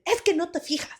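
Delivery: high-pitched scolding without shouting; imitated stern dad, volume held back; stern, not yelling. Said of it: "But not yelling at me".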